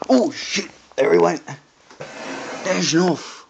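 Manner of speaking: sudden loud startled cry; startled shout. Real, not acted. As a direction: jump and yell as it flies. Bird suddenly flies off.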